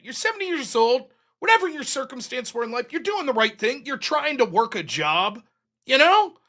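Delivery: normal talking voice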